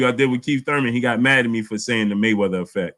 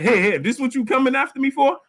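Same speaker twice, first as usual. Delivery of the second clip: mocking Lomachenko; 12 semitones higher than usual. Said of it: "and Lomachenko said".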